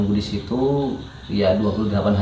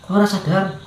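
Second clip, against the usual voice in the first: surprised caretaker; 9 semitones higher than usual. Retold or imitated.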